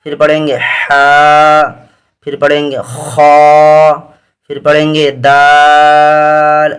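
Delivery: ہا، خا، دال each drawn out slowly; دال held long in a clear reciting voice; stretched recitation. Shown as a demonstration. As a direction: stretch the final letter name, slow. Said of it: "میں نے اس کو کھینچ کر کے پڑھا ہے".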